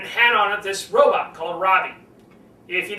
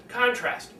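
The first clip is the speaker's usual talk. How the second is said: ordinary delivery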